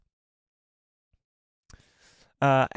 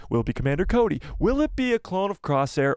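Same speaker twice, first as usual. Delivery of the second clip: ordinary talk, nothing marked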